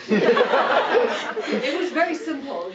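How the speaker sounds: slow, high words through real laughter; laughing. Real, not acted. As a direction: say it while still laughing at the joke. Laughing at her own list.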